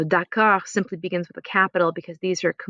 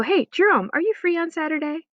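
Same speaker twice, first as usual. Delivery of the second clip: higher, lively question to a friend; mock invitation; 9 semitones higher than usual. Shown as a demonstration. Teacher acts the English invitation, higher. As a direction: play the friend asking, upbeat.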